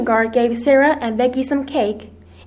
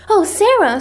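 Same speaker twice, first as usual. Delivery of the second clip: higher-pitched cry of concern; alarmed cry; 8 semitones higher, 4 dB louder than usual. A narrator's reading. Ermengarde sees her tired friend, alarmed cry. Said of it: "she cried".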